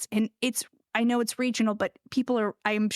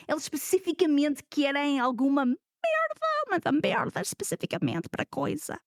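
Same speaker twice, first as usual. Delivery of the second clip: animated Portuguese with lively pitch jumps. Shown as a demonstration. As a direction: rattle it off in Portuguese. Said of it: "I can do it in Portuguese".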